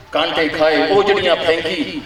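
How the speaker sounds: normal talking voice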